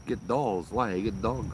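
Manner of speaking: ordinary talk, nothing marked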